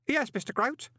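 high, eager reply; startled young helper. A narrator's reading. Daydreaming lad answers the call.

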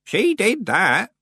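put-on high, very slow rustic old man; agreeing old man. A narrator's reading. Old man confirms to Nanny, agreeable. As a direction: drawl agreement as an old countryman.

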